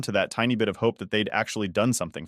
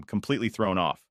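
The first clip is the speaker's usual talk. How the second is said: ordinary delivery, nothing marked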